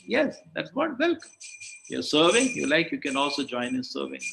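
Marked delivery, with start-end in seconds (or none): 0.1-1.3 high-pitched delighted yes; spring up with approval. Real, not acted. Approving the right answer.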